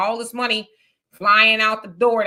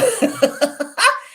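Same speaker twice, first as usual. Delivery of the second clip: loud laugh; 13 semitones higher, 5 dB louder than usual. Real, not acted. Laughing at caller's comment.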